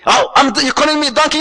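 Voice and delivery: acted, light-hearted outrage; mock offended. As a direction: play a husband taken aback by an insult. Acted husband takes offence at wife.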